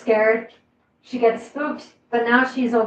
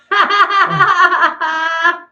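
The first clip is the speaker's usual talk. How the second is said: hearty open laugh, loud and high; loud burst of laughter; 5 semitones higher, 10 dB louder than usual. Real, not acted. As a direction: crack up out loud. Reacting to a funny chat joke.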